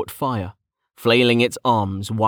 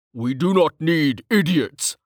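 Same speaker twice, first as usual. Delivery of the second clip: slow, raised-pitch, mildly angry rebuke; snapped; 6 semitones higher, slower than usual. A narrator's reading. Senior officer dismissing the young mage, snapped. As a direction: press each word slowly at him. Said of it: "Vink snapped".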